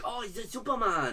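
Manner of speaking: lightly put-on African accent, half joking; terrible African accent. Friend jokes about a Superman logo, in a bad African accent. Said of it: "That was my terrible African accent".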